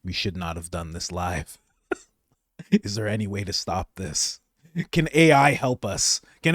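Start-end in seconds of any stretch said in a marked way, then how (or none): 0.0-1.6 hushed, lower mock panic; hushed imitation of panicked hosts; mock regretful mutter. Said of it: "They must be sitting there like".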